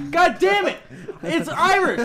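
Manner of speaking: high, loud yell breaking into laughter; exasperated shouting. Real, not acted. Arguing with friends over Irish or Italian, laughing shout. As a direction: blow up over it, half laughing. Heated mock row over accent.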